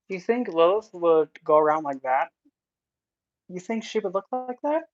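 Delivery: loud, indignant rhetorical question; incredulous. Real, not acted. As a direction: throw the question out loudly, rising high in disbelief. Rebuking an impostor's claim to be Lilith.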